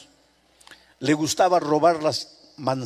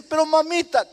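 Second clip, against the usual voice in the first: put-on high, louder cry of a kneeling boy; pleading, near tears; 13 semitones higher, 4 dB louder than usual. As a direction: beg from your knees, close to crying. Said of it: "cayó de rodillas, llorando". (súplica aguda de niño a punto de llorar)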